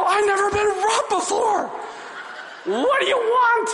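very high, loud, laughing panic; hammed-up scared young clerk; frightened hostage. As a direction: squeak it out in mock terror. Frightened victim.

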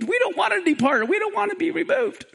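ordinary talk

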